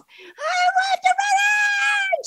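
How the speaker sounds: very high, slow drawn-out shout; mock child scream. Mom imitating her daughter screaming in the backyard. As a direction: wail it like a furious little girl. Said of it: "She was just screaming".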